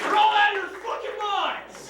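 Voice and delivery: high-pitched outraged shout at the crowd; high outraged yell. Acted. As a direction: yell it high, as if they are crazy. Heckling crowd after applause poll.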